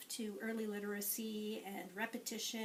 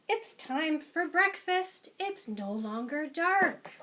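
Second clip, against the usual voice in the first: higher, louder eager dog; 6 semitones higher, 9 dB louder, slower than usual. Acted. Teacher voicing the dog for children, higher and louder. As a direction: announce breakfast as the dog. Dog character quote in story.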